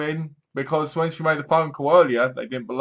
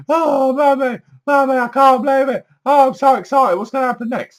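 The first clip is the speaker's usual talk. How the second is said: put-on much higher and louder, gushing like a thrilled kid; excited twelve-year-old; 10 semitones higher, 6 dB louder than usual. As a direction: squeal it to mummy like an overexcited child. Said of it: "My twelve-year-old self".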